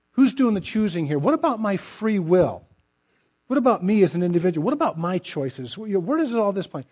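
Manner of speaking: flustered questioning. Retold or imitated. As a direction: play the confused believer all wound up. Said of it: "we get a little bit wound up like a rubber band and say".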